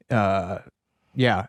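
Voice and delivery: ordinary delivery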